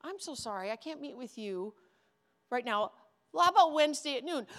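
very soft sweet apology; quiet fake-sorry deferral; soft polite brush-off. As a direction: hush it like a gentle put-off. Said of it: "schedule them".

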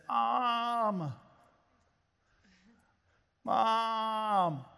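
put-on child calling out. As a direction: call across the hall for mom, a bit persistent. Son calling from his bedroom.

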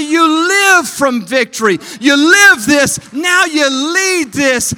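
slow, shouted declarations; full-force preaching. Real, not acted. Preacher shouting a challenge to church leaders. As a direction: drive each phrase home hard.

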